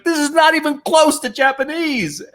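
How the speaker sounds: exasperated at the absurd request; incredulous inner voice. Retold or imitated. Actor in audition thinks in high-pitched disbelief. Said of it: "I'm sitting there going".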